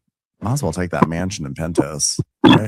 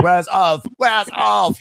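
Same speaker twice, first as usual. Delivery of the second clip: mock angry; 12 semitones higher, slower than usual. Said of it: "He's so mad".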